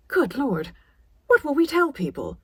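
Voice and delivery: mother panicking about appearances; horrified. A narrator's reading.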